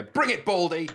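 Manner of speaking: cocky challenge rising on the last word; mock defiance. Developers taunting the reviewer, mock defiant. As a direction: throw down a playful taunt.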